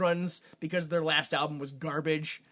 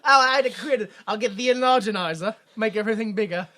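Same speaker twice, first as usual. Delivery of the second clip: mock British accent; 3 semitones higher, 6 dB louder than usual. Comedian ordering an invented British burger upsize, hammed-up British.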